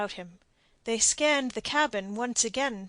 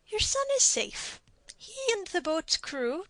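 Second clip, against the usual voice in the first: much higher, soothing captain; Captain Blood; 10 semitones higher than usual. A narrator's reading. Blood tells the don his son is safe, reassuring. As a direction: reassure him lightly.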